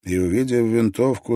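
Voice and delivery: usual speaking voice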